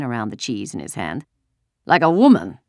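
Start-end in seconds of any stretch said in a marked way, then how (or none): 1.8-2.6 loud, scornful jab; contemptuous. A narrator's reading. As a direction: toss it in with a soldier's contempt. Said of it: "he added with contempt".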